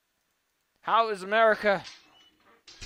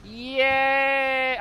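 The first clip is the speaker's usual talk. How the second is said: excited cheer; 6 semitones higher, 6 dB louder than usual. Real, not acted. Cheer as car moves.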